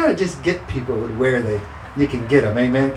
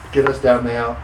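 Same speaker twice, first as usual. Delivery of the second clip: ordinary talk, nothing marked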